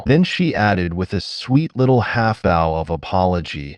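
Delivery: plain reading voice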